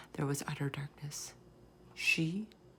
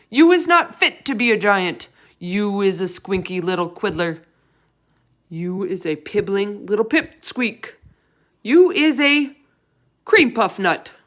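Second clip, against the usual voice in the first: louder, higher giant trading jibes; angry insults; 4 semitones higher, 10 dB louder than usual. A narrator's reading. The giants insulting each other, in loud angry jibes. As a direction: hurl insults back and forth.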